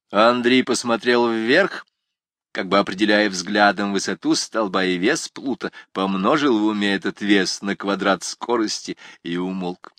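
plain reading voice

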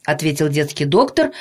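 usual speaking voice

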